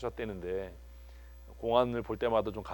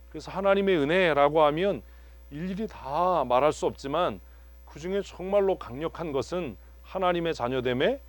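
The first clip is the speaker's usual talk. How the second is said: louder, higher preaching build; 6 semitones higher, 4 dB louder than usual. Acted. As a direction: preach it with rising force. Preaching climax.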